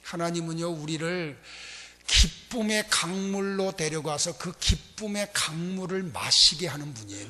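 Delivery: usual speaking voice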